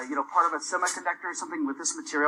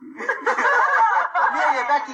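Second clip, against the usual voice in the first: laughing; 9 semitones higher, 4 dB louder than usual. Real, not acted. Teacher laughing at gas voice.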